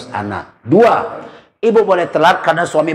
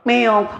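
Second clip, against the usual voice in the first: cat meow imitation; 7 semitones higher than usual. Cat interrupts prayer.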